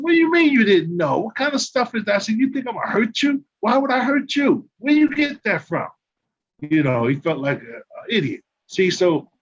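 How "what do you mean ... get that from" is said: higher, rising indignation; confronting a teammate who misunderstood a joke; indignant confrontation. Retold or imitated. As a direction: demand an answer, building up. Confronting teammate at his door.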